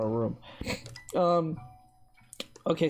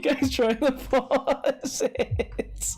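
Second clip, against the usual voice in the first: laughing through the words; giggling slow question; 8 semitones higher, much slower than usual. Real, not acted. Laughing at viewers pausing.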